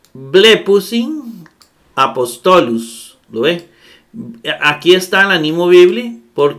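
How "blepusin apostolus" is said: slow, careful Greek reading. Shown as a demonstration. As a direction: spell out each syllable of "blepusin apostolus" slowly. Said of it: "De esta manera". (lectura lenta y marcada de "blepusin apostolus")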